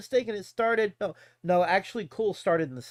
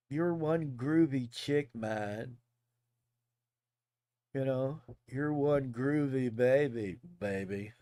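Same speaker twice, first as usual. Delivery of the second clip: groovy hipster; 6 semitones lower, 4 dB quieter, slower than usual. Retold or imitated. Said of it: "terms like groovy".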